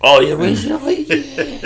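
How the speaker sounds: high, slow laughing recognition; light up remembering the song; fond laugh. Real, not acted. Hearing his favourite song named, high laughing recognition. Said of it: "my favourite one's one called Residue".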